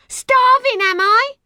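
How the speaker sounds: outraged, piercing yell; top-of-the-voice scream. A narrator's reading. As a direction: shriek it high and loud. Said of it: "screamed at the top of her voice".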